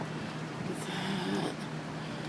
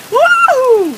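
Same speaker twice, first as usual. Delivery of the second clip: high joyful whoop; 6 dB louder than usual. Real, not acted. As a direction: let out a thrilled whoop. Whooping in the wind and rain.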